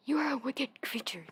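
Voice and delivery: crying out. A narrator's reading. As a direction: cry out indignantly at the witch. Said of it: "cried Dorothy".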